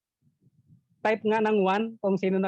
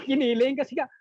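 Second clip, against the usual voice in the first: normal talking voice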